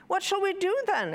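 put-on high, quick child voice; child's question. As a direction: ask like a child at a loose end. Child asks mother in story.

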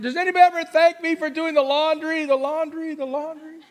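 high-pitched, hammed-up household grievance; comically indignant pleading; mock exasperation. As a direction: complain as the unthanked one, harping on the chore. Acted complaint of unthanked housework.

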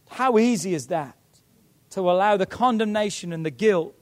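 ordinary talk, nothing marked

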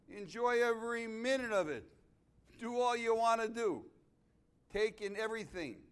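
loud and high, building sermon; full-force preaching. Real, not acted. Pastor warning the old about judgment day, preaching loud. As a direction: preach it hard to the congregation.

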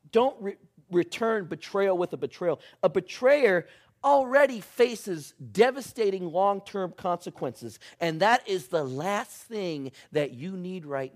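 loud, urgent, pressing preacher; preaching at full force. Acted. Pastor urging the congregation not to seek revenge, preaching hard. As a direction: drive it home to the congregation.

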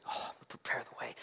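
whispered call to prepare the way. Acted. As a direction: breathe it out in a whisper. Echoing John's message.

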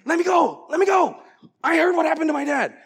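yelling high and loud, struggling to break free; angry shout. Retold or imitated. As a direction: shout to be let go, furious kid. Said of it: "And I'm like".

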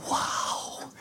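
hushed, voiceless amazement; awed whisper. Retold or imitated. Two friends stunned after deliverance, awed whisper. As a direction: breathe it out in mock awe. Awed reaction retold, unvoiced.

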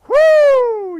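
high, loud roller coaster whoo; thrill whoop. Acted. Pastor mimicking a roller coaster drop, loud, high whoop. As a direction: let out a rush of thrill at the drop.

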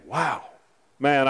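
ordinary delivery

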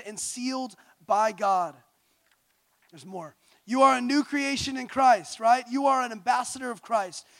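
steady emphatic list, each "You are" stressed; rhythmic proclamation of identity; declaration. Acted. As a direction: declare each line like a creed.

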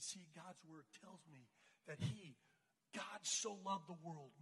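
slow, soft, low reverence; sincere scripture quoting. Retold or imitated. Girl quoting John three sixteen to classmates, softly sincere. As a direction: quote it gently and deliberately.

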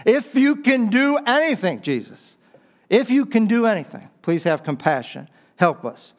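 high, pleading cry of a desperate father. Acted. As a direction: beg Jesus like a father at the end of his rope. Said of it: "the father pleads with Jesus".

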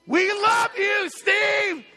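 loud, high, slow shouted cheer; crowd shout. Real, not acted. Congregation shouting a cheer to sick Steve listening at home, loud. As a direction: blow his hair back with the shout. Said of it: "I want you to blow his hair back".

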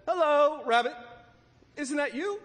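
high, singled-out hello into a hole; calling out. A narrator's reading. Pooh calls down into the hole.

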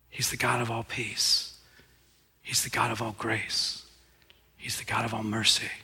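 soft, hushed proclamation; hushed reverence. Real, not acted. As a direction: whisper it like a holy secret. Sermon closing, hushed worship.

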